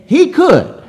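high, ringing burst of conviction; emphatic affirmation. Real, not acted. Preacher answering whether God could turn the nation, emphatic. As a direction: answer with soaring certainty.

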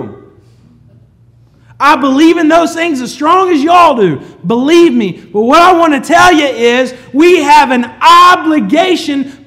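loud, high, driving preacher's voice; full-force preaching. Real, not acted. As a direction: preach it out to the whole congregation.

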